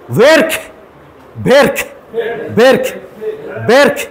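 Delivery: ভের্ক with a rolled r and short crisp k, rising; Russian pronunciation. Shown as a demonstration. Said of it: "রাসান প্রনাউন্সিয়েশনে ভের্ক". (রুশ উচ্চারণে ভের্ক)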